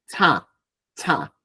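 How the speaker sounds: CHA pushed forcefully from the nose; nasalised "cha" syllable; nasal cha. Shown as a demonstration. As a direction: drive "Cha" out through the nose. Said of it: "sale con fuerza desde la nariz".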